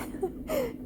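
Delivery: soft high laugh-sob; real half laugh, half cry; tearful laugh. Real, not acted. Tearful laugh while choked up.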